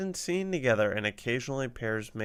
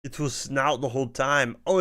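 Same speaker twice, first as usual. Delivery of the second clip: ordinary talk, nothing marked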